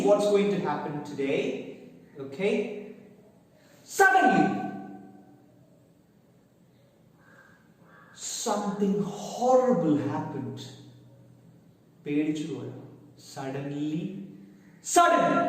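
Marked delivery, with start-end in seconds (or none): 4.0-10.6 dramatic suspense. Shown as a demonstration. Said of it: "teacher dramatizes story suspense". 14.9-15.4 SUDDENLY shouted high and loud; Suddenly cried out high, sharp and loud; dramatic exclamation. Shown as a demonstration. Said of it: "Suddenly means".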